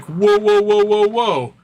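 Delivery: high, loud string of protests; mock alarm. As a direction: halt them in hammed-up alarm, voice jumping up. Acts cartel's alarmed objection.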